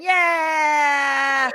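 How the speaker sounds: high, loud drawn-out yeah; triumphant cheer. Retold or imitated. Listener acting out the founder's victory moment, triumphant cheer. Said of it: "you were there going".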